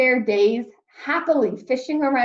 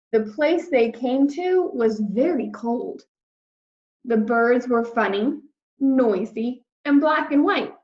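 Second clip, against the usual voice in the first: ordinary delivery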